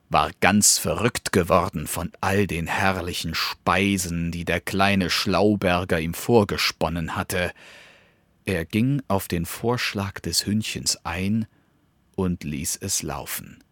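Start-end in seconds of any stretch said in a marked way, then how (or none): none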